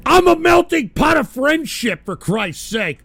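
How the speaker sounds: usual speaking voice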